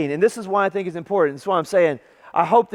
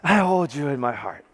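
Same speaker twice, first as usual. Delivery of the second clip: lower, hammed-up sweet nothing; mock romantic; 4 semitones lower than usual. Shown as a demonstration. Showing married couples how to help the relationship, mock romantic. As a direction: demonstrate a swoon-worthy love line. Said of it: "You gotta say it like that".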